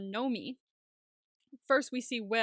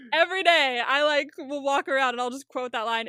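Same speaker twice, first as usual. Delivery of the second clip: normal talking voice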